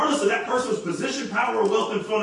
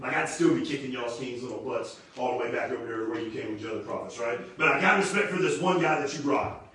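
trash talk; 6 semitones lower, 4 dB quieter than usual. Retold or imitated. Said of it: "Elijah's saying straight up".

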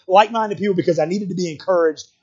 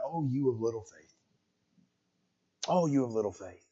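soft, much quieter whisper in the ear; 7 semitones lower, 15 dB quieter than usual. Acted. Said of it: "just whispering".

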